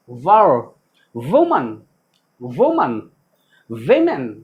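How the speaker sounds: low, isolated words with pauses between; read-along pronunciation drill. Shown as a demonstration. Teacher leading students to read along, measured drill. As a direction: recite each word in English pronunciation for repeating. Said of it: "पढ़िए मेरे साथ".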